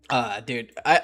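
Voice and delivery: ordinary talk, nothing marked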